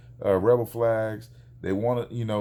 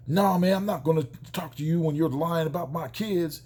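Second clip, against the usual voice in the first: imitating the neighbour's angry protest, higher; retold angry refusal; 6 semitones higher than usual. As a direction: act out an indignant father refusing to talk. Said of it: "started yelling and screaming".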